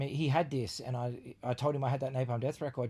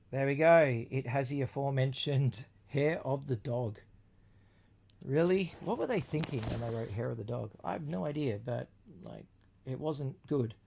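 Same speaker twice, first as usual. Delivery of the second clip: ordinary talk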